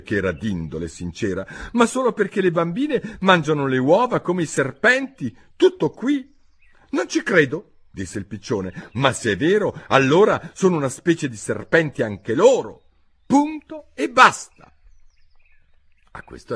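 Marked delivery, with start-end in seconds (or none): 1.7-6.3 put-on high, loud girl's explanation; high-pitched insistent girl; self-justifying Alice. A narrator's reading. 6.9-7.8 high, loud refusal; high-pitched flat disbelief; disbelieving pigeon. A narrator's reading. 8.9-14.7 high, loud verdict, climbing at the end; high-pitched emphatic bird; dogmatic pigeon. A narrator's reading.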